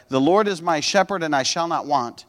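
"shepherd and I shall not want" run on as one casual sentence; misread run-together reading. Shown as a demonstration. Preacher showing how he misread it when younger, run together casually. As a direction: read it straight through, understated, without weighty pauses. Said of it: "I read it this way".

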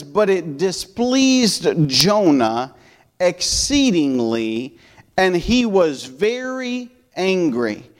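slow, solemn Bible reading; scripture reading. Acted. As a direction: read each phrase slowly and deliberately. Reads King James scripture aloud.

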